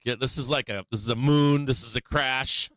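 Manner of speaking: ordinary talk, nothing marked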